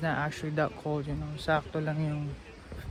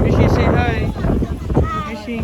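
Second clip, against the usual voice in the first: calling out; 7 semitones higher, 7 dB louder than usual. Real, not acted. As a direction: call out across the space. Calling to child nearby.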